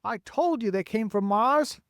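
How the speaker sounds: high, vindicated call. A narrator's reading. Police chief to fire chief, calling out vindicated. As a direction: call it out as proof you were right. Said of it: "called the chief of police".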